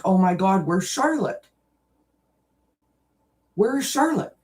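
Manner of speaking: retold panic, pitch climbing; worried calling out. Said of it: "I'm calling her and I'm looking".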